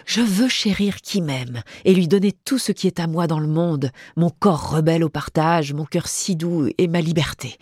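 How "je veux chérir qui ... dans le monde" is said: ordinary delivery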